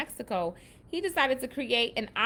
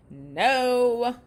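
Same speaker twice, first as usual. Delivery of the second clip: loud, raised, drawn-out mock "no"; mock objection; 4 semitones higher, 6 dB louder than usual. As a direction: exclaim it as a playful retold protest. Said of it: "but Katora was like".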